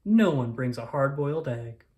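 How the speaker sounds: plain reading voice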